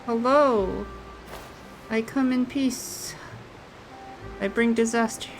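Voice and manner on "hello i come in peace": normal talking voice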